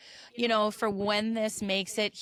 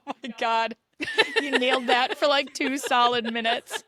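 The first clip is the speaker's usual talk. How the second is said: laughing; 5 dB louder than usual. Real, not acted. Laughing through talk.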